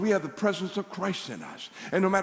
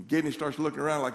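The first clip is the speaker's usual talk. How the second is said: usual speaking voice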